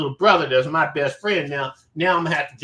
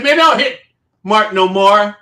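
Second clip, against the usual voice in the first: loud, raised-pitch warning from a retold confrontation; 3 semitones higher, 7 dB louder than usual. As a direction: call out a warning, acting it out.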